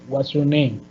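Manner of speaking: "What's your name?" dropping on "name"; WH-question closing downward on "name"; falling intonation. Shown as a demonstration. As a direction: let "name" go down at the end. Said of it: "va bajando".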